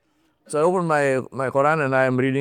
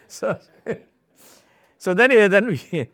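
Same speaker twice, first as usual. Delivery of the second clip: laughing through words; 7 semitones higher than usual. Real, not acted. After joking about being a sultan, laughing as he resumes. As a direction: still chuckling while talking on. Laughing at his own joke.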